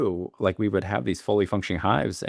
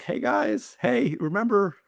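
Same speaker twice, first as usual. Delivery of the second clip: high-pitched mock reminder, drawn out at the end; singsong reminder; 11 semitones higher, slower than usual. Beekeeper reminding a too-comfortable crew about the bees, high singsong. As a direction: call it out high, imitating a polite plea.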